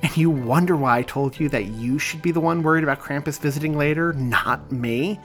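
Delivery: angry retort. Acted. Aaron taunts back angrily.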